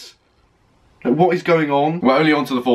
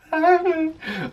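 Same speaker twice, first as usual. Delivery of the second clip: laugh. Real, not acted. Gushing over falsetto.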